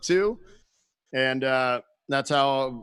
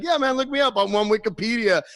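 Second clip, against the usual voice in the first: imitating the guy; 7 semitones higher than usual. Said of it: "he called me on the phone and he's like".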